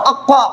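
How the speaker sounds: अक्वा with a heavy doubled q from the back of the throat and rounded "aw"; thick deep-throat q. Shown as a demonstration. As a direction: make the q in अक्वा full and heavy. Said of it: "मोटा करेंगे".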